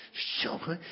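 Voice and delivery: a broken whisper of Zo gemeen; whispered hurt. Real, not acted. Still hurt by the letter, now only whispering. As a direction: breathe Zo gemeen out with no voice.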